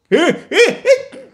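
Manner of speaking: squeaky high laugh; a quick tickled giggle. Acted.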